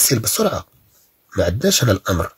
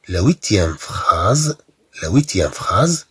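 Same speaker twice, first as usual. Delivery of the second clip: very slow, drawn out; slow announcement; much slower than usual. Shown as a demonstration. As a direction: announce the sentence number slowly. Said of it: "announcing the next sentence". (إعلان بطيء للجملة)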